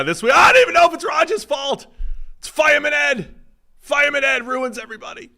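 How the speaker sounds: very high, loud mock meltdown; ranting fan impression. Mimicking this week's rant blaming Fireman Ed, mock outraged shout. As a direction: yell the blame like an overwrought caller. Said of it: "last week it was".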